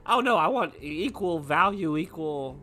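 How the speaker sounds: ordinary talk, nothing marked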